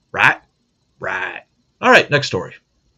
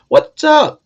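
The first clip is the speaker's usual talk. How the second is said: high excited greeting; 7 dB louder than usual. Retold or imitated. Reading the caller's greeting.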